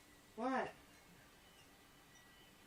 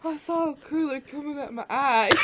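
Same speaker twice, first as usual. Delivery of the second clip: hysterical wail; 8 dB louder than usual. Real, not acted. Retells the vision, overcome.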